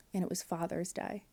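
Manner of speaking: ordinary delivery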